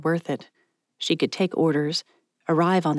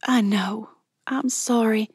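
higher, earnest voice, gathering speed; apologetic younger friend; 6 semitones higher than usual. Acted. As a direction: pour out a heartfelt apology. Voices Mallory apologising to Patty.

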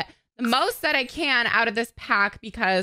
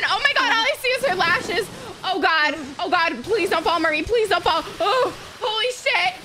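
anxious excitement; 7 semitones higher than usual. Real, not acted. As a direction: beg her not to fall, thrilled. Nervous watching Marie.

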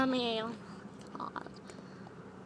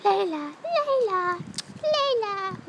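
high, drawn-out sweet calling; singsong pet call; 11 semitones higher, much slower than usual. Real, not acted.

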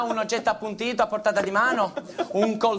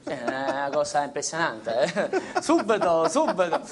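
soft, slow hypnotist voice; voce da ipnotizzatore; 5 semitones lower, 3 dB quieter than usual. Acted. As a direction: lull her into a trance, softly. Ipnotizza la signorina. (voce ipnotica, lenta e sommessa)

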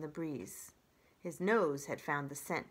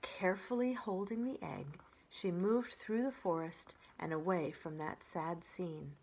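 ordinary delivery, nothing marked

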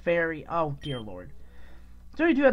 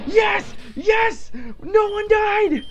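high, drawn-out victory shout; excited cheering; 12 semitones higher, 6 dB louder, much slower than usual. Real, not acted. Gamer shouting with joy that nobody fainted. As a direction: cheer like you just survived. Celebrating a won battle.

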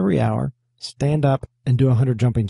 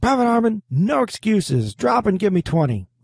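barking drill sergeant; 8 semitones higher than usual. Retold or imitated. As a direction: bark orders like a drill sergeant. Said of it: "he would cut you off".

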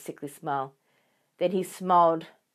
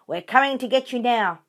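loud, higher threat; yelling taunt; 6 semitones higher, 6 dB louder than usual. A narrator's reading. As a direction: taunt the fox with a yell. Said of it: "yelled Bunce".

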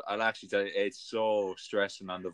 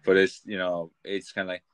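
ordinary delivery

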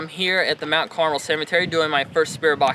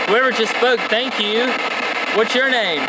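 calling out to spirits; 5 semitones higher than usual. Acted. Investigator addressing spirits at the cemetery, a little raised. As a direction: call out to anyone listening.

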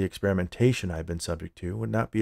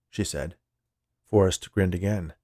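usual speaking voice

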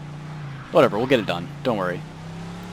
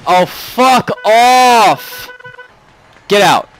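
very slow, higher, louder drawn-out yell; furious shout; 8 semitones higher, 6 dB louder, much slower than usual. Real, not acted. Streamer yelling at a driver in the game, furious shout. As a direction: drag out the curse, then bark get out twice.

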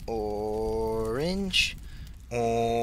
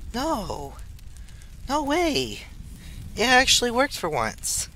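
surprised; 10 semitones higher than usual. Real, not acted. Disbelief at success.

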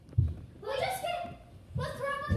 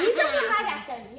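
giggling through the words; laughing; 8 dB louder than usual. Real, not acted. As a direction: laugh while you say it. Siblings bickering while building fort.

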